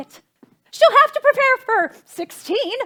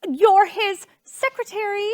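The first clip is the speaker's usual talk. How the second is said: ordinary delivery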